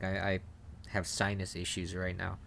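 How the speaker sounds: stifling a sneeze, sniffly; talking through an oncoming sneeze; holding back a sneeze. Real, not acted. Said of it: "I'm trying not to sneeze".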